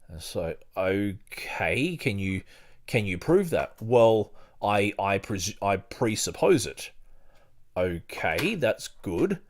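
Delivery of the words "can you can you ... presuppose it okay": normal talking voice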